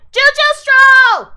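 high, loud, drawn-out yell; excited shout. Real, not acted. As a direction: belt the title out slowly. Yelling the show title.

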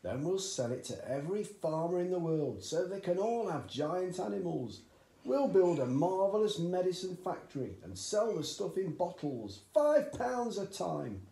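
high, loud, eager father; eager dad. A narrator's reading. Excited father urging his son at breakfast, high and loud. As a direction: push the boy on, thrilled with the idea. Cranky dreams of riches.

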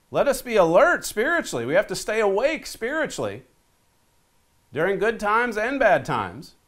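rally the flock to stay awake; preaching with rising fervor; fervent preaching. Acted. Rising sermon exhortation.

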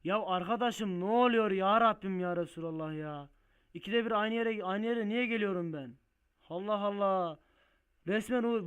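loud, high-pitched frustrated rant; real exasperation. Real, not acted. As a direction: wail in protest at the game. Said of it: "Vallahi sinirlerim bozuldu arkadaşım". (sinirleri bozulmuş, bağırarak isyan)

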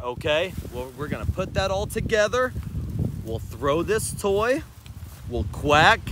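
usual speaking voice

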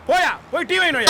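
tag. Acted. Angry dismissal of a doubter.

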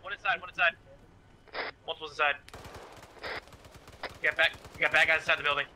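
urgent shouted contact callout; alarm shout. Real, not acted. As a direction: yell the warning to the squad. Enemy contact called in firefight.

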